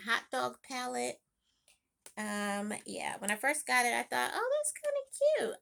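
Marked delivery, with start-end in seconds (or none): 4.3-5.6 high, bright-eyed mock delight; mock gushing. Recalling her first impression, high mock gush. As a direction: squeal it like a first crush on the palette.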